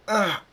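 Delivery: short, high, loud "ah"; acted cry of pain. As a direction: cut in with a sudden yelp. Magic suddenly hits Thunderstorm.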